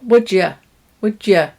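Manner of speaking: "Would ya" with a schwa; weak form. Shown as a demonstration. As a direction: run "you" down to "ya". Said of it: "We change the vowel in the weak forms".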